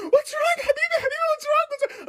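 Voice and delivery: very high-pitched nurse impression; put-on high Russian nurse voice. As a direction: squeal it like a fussing nurse. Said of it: "I had a Russian nurse".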